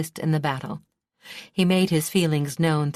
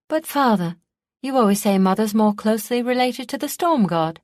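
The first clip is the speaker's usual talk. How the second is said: high, bright-voiced cheeky comeback; pert; 6 semitones higher, 5 dB louder than usual. A narrator's reading. Son cheekily teases his father, pert and higher voiced. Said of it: "Markin replied pertly".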